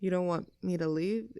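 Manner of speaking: ordinary talk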